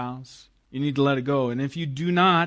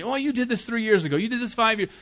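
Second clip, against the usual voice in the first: high, accusing hammed-up complaint; mock nagging spouse; 7 semitones higher than usual. Preacher acts a spouse bringing up past sins, mock nagging. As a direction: dig up old sins like a bickering spouse. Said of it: "you can't bring that up in the argument".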